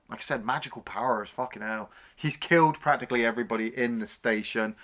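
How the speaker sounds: ordinary delivery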